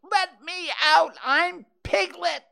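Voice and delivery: very high, loud, slow wail; crying out. A narrator's reading. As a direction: struggle and protest, stretching each word. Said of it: "cried Piglet".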